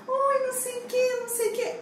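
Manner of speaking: very high, soft, sugary little voice; mock cutesy colleague. Imitating her all-sweet R2 talking, mock cutesy. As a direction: imitate a sweetie-pie in a tiny high squeak. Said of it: "ela é toda fofinha".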